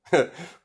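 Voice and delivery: short high laugh, acting out Rei; a quick amused burst of laughter. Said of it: "Rei's just laughing".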